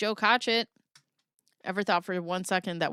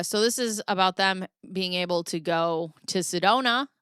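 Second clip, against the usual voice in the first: usual speaking voice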